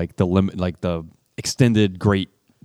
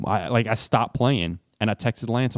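usual speaking voice

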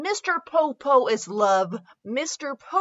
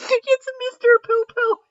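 mock gasp; 12 semitones higher, 3 dB louder than usual. Big reveal in the fanfic.

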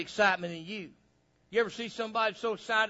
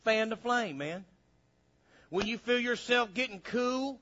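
usual speaking voice

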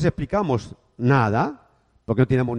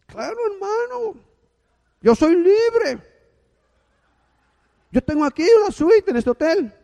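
put-on Cuban accent; 6 dB louder than usual. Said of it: "era cubano".

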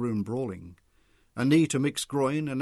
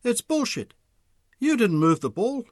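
loud, higher firm call; 11 semitones higher, 8 dB louder than usual. A narrator's reading. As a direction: state it firmly over the crowd.

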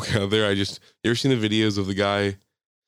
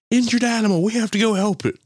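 put-on higher, louder earnest kid voice; kids' voice; 12 semitones higher, 4 dB louder than usual. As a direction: play the worried kids. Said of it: "they're like".

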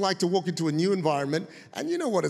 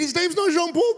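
high, incredulous retold question; hammed-up amazed questioning; gleeful disbelief; 8 semitones higher, 3 dB louder than usual. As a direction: press the point in high-pitched delight. Retold gleeful questioning of his wife.

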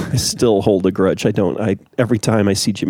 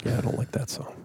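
whisper; 14 dB quieter than usual. Real, not acted. Joking aside to co-host.

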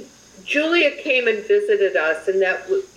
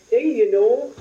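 mock drawl; 4 semitones higher, slower than usual. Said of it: "They don't have the Uber drawl".

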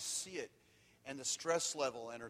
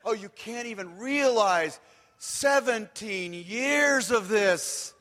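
loud slow exclamation; 8 semitones higher, 13 dB louder, much slower than usual. Real, not acted. Exclaiming about seventeen hard years.

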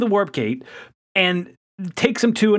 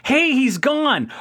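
put-on kids calling out; 9 semitones higher, much slower than usual. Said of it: "then the kids are like".

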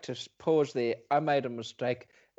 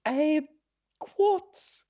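high, squeaky character voice; high caller voice; 11 semitones higher than usual. Acted. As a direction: play a silly caller. Plays caller Monotony.

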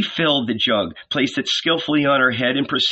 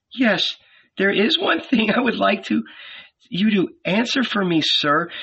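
higher, earnest questioning woman; woman's voice; 6 semitones higher than usual. Acted. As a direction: plead for an answer. Woman asks her question.

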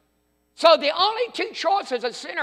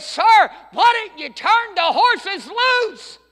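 1 urgent plea; 8 semitones higher, 5 dB louder, slower than usual. Retold or imitated. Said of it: "ran and said".

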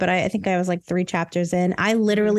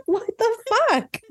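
high, incredulous outburst; a pitched-up mock gasp of shock; 12 semitones higher than usual. Retelling her shocked reaction.

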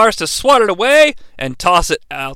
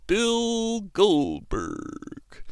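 slow, softer, stretched-out delivery; drawn-out name; 2 semitones higher, 13 dB quieter, much slower than usual. Acted. Kevin Nash boasting of his match, slow drawn-out name. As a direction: draw out each syllable as it trails away.

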